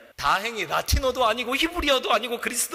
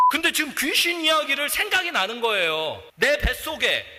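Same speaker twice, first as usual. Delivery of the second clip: usual speaking voice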